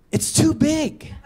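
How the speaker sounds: high, drawn-out mock complaint; imitated wife balking. Wife balking, acted by husband.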